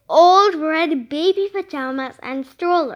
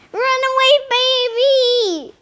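higher, loud warning yell; man yelling; 8 semitones higher, 3 dB louder than usual. A narrator's reading. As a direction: cyclist shouting as he swerves. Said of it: "yelled a man".

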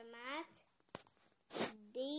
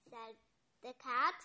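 usual speaking voice

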